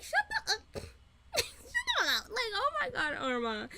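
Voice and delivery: squealing with laughter, high pitched; laugh-crying out the annoyance; laughing, high and exasperated. Real, not acted. Mock-annoyed outburst, laughing.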